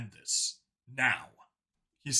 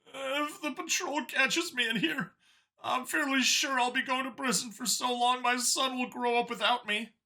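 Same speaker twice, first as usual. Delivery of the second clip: pleading Xeno; 11 semitones higher than usual. A narrator's reading. Xeno begging Vincent about his son, high and worried.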